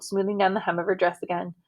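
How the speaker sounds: ordinary delivery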